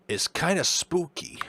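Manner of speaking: a touch of the doctor's voice; the doctor remarking on a painting, lightly voiced; doctor character voice. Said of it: "I'm gonna drop this voice".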